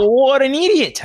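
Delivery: high, loud mocking scoff; scornful. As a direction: sneer at his carelessness. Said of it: "what we're all thinking is like".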